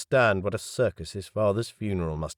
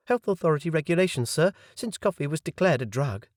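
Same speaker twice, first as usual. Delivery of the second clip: high, quick, bright waitress voice; serving girl voice; 7 semitones higher than usual. Acted. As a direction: rattle it off like a cheerful waitress. Waitress explains the rule.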